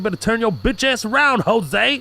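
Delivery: hammed-up gangster threat; gang member threat. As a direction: warn him off the block.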